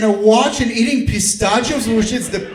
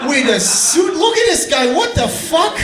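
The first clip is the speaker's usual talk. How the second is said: comic disbelief; 7 semitones higher than usual. Real, not acted. Comedian stunned by a suited man eating pistachios, high-pitched disbelief. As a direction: act stunned by the snack.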